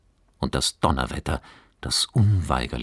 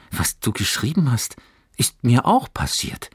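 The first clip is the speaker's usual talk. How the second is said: high, bright-eyed eager voice; eager call; 7 semitones higher, 4 dB louder than usual. A narrator's reading. Johannes telling Fabian eagerly, a touch of excitement. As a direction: blurt it out with excitement. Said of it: "rief er begeistert".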